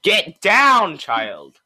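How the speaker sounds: loud, high command, dropping on the last word; scolding shout. Real, not acted. Co-host orders someone to get down, scolding shout.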